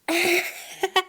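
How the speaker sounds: villainous cackle on request; evil laugh. Pretend. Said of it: "let me hear your evil laugh".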